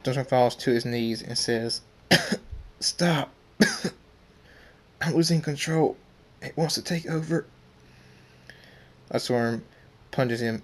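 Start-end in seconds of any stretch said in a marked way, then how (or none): 2.1-2.8 acted cough after a beating; pained coughing. Beaten character falls to his knees. 2.8-7.5 higher voice, coughing through the words; strained plea. Acted. Beaten character losing control, pleading.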